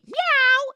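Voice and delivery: squeaky high loud meow; mock cat meow. Cat meowing in the dark house, high squeaky meow. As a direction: imitate a startled cat.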